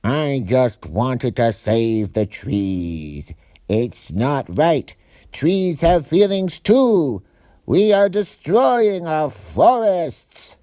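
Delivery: a tree lover justifying himself, building up; thief's plea. A narrator's reading. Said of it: "the thief spoke up".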